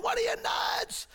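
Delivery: high, outraged heckle; yelling dads. Acted. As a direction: yell in disbelief from the stands. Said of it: "Dads are yelling".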